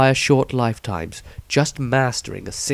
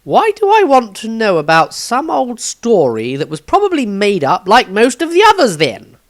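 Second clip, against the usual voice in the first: put-on higher, louder, puzzled young apprentice; young Maestro voice; 11 semitones higher, 7 dB louder than usual. A narrator's reading. Narrator voicing young Maestro, puzzled, higher and louder. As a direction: voice the confused young student, pitched up. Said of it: "Maestro looked a little confused".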